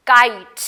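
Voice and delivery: Kite with a loud, punched K; stressed K sound. Shown as a demonstration. Teacher stressing K in kite for kids. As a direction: hit the K of kite hard. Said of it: "K sound is क".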